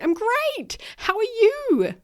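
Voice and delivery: high, loud, sing-song fake cheer; chipper automatic reply. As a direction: brightly pretend all is well. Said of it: "I went".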